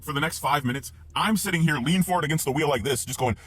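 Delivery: ordinary delivery, nothing marked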